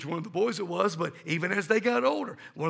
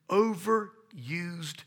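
drawn-out word; much slower than usual. Shown as a demonstration. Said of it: "slowed for emphasis".

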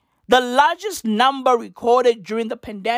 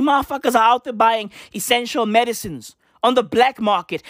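ordinary talk

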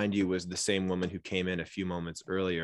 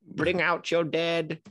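slow, higher, drawn-out calling of the dead; Monty Python crier, slow and high; mock town crier call; 9 semitones higher, slower than usual. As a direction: call it out slowly like a plague cart man. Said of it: "this man screaming".

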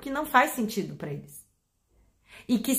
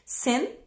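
Sen said alone, high and firm like a dog command; a short firm Sen for the dog; stressed command syllable; 5 semitones higher than usual. Shown as a demonstration. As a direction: bark out just Sen with the stress on it. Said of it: "a sílaba onde eu ponho a maior tônica".

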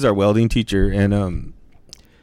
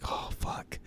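whispered oh fuck; 16 dB quieter than usual. Retold or imitated. Retold panic whispered to himself.

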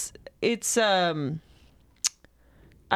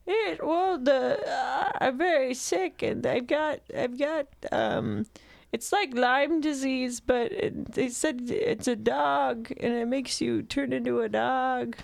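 sick grandmother voice; 3 semitones higher than usual. Acted. Wolf as grandma explaining the sickness, frail put-on voice.

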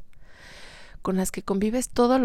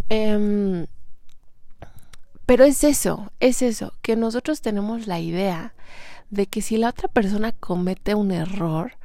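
usual speaking voice

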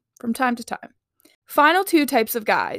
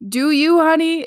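higher, louder cheeky "do you, honey"; playful sass to the fish guys; sassy encouragement; 6 semitones higher, 5 dB louder than usual. Real, not acted. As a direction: tease with a wink in the voice.

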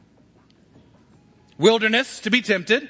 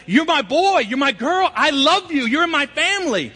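high, loud, joyful father's declaration; Father's loving call; 9 semitones higher, 4 dB louder than usual. Pretend. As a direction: call it out like a proud dad. Father speaks to his children.

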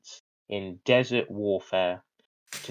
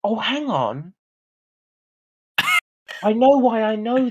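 high, eager burst of recall; sudden eager remembering; excited realization; 11 semitones higher, 6 dB louder than usual. Real, not acted.

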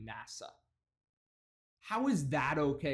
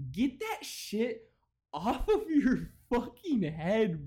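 drawn-out high mock voice; 4 semitones higher, slower than usual. Mock drawl rant.

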